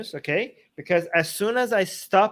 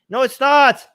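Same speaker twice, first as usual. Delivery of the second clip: firm rebuttal; 7 semitones higher, 10 dB louder than usual. Real, not acted. Rebutting parents who claim ownership.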